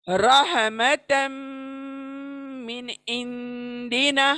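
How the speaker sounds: slow chant of रहमतम मिन इंदिना, मिन clear, इंदिना nasal; tajweed recitation. Shown as a demonstration. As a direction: recite slowly, clear नून then hidden नून. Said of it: "एक नून को मैं fast पढ़ रही हूँ, clear पढ़ रही हूँ".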